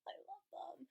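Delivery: breathy whisper of affection; whispered aside. Real, not acted. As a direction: whisper it like a secret crush.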